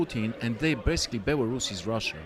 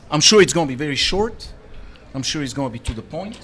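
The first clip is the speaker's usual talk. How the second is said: mock confident; 4 semitones higher, 10 dB louder, faster than usual. Teasing about Susan's ad copy.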